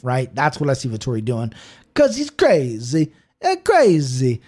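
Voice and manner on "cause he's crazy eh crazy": hammed-up Italian accent with drawn-out vowels. Podcaster imitating why he backs Vettori, in a mock Italian accent. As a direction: put on a playful, sing-song Italian accent. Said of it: "I love doing my Italian accent".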